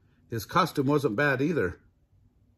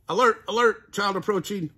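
high and loud alarm, not whispered; alarmed lookout call; 8 semitones higher, 5 dB louder than usual. A narrator's reading. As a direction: sound the alarm in a sudden burst. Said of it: "whispered Cow".

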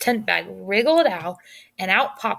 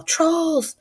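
yelling; 8 semitones higher than usual. Acted. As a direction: raise the alarm at the guards. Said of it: "he heard her yell".

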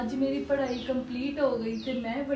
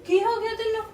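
shocked; 5 semitones higher, 5 dB louder than usual. Acted. Niece sees aunt's state.

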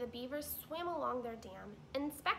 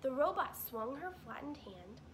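ordinary delivery

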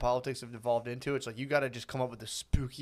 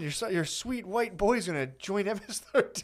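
mock fearmonger; 6 semitones higher than usual. Mock fearmonger warning parents.

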